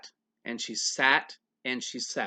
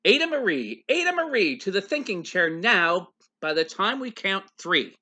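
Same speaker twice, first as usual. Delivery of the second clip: loud, higher stern scolding, rising on the second Ada Marie; scolding parent; 5 semitones higher, 6 dB louder than usual. Acted. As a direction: call her out like a cross parent.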